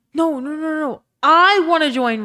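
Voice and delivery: playful loud protest. Real, not acted. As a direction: refuse loudly, then stretch the wish out. Loud protest at a chat offer.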